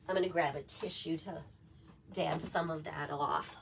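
hoarse, rough-edged voice; a raspy voice, trailing off quiet. Real, not acted. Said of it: "How do you like my raspy voice".

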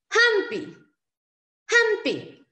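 HAMPI high and loud, HAM held, PI falling; syllable demonstration. Shown as a demonstration. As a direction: model HAM-PI for learners. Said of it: "consonante, vocal, consonante".

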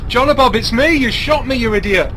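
loud, exasperated yelling at him; angry yell. Real, not acted. To the teammate who shot him, loud exasperated yelling. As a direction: shout him down for shooting you.

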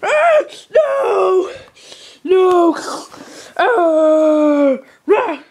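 high, loud panicked yells; panicked screams. Acted. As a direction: scream as you're eaten. Being eaten, screaming.

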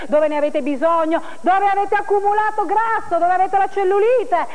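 ordinary delivery

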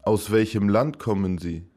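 normal talking voice

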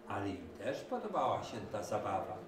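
usual speaking voice

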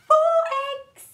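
high, loud excited count. Acted. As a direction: burst out with delight.

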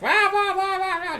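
high whiny wah-wah mockery; whiny wife voice. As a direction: whine it like a nagging wife, pitched high. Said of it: "the whiny wife voice".

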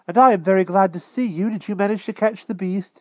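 put-on high, loud boy's voice; eager boy. A narrator's reading. Young Wart asks about the hunt, eager. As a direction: pipe up politely and keenly, high.

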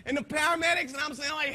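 ordinary talk, nothing marked

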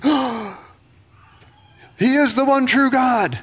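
high-pitched mock amazement; wide-eyed, drawn-out realization; awestruck. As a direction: cry out as a suddenly convinced crowd. Silent crowd now astonished by fire.

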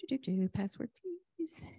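ordinary delivery

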